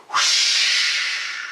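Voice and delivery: loud breathy whoosh of a gust; whooshing wind. Acted. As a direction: blow it out like a howling wind. Wind bursts through the door.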